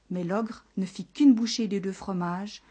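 ordinary delivery

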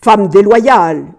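loud, slow, drawn-out accusation; furious yell. A narrator's reading. Husband throwing his wife to the ground, yelling. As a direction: hurl the words at her. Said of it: "hurla-t-il en la jetant".

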